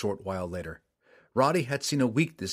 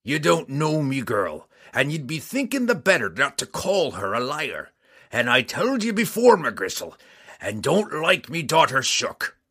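a dwarf squaring up to a bully; menacing dwarf; 4 semitones higher, 6 dB louder than usual. Acted. Dwarf threatens McGristle.

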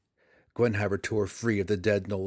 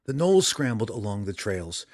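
ordinary delivery

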